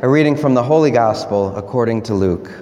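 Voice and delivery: measured, solemn liturgical reading; Gospel reading. Acted. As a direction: read the Gospel aloud to the congregation.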